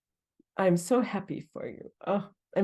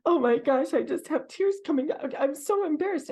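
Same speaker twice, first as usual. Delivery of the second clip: higher-pitched, flustered mimicry of students; embarrassed workshop student; 8 semitones higher than usual. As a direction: imitate someone apologizing for their tears. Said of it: "many people will say".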